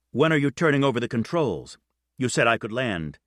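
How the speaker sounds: ordinary delivery, nothing marked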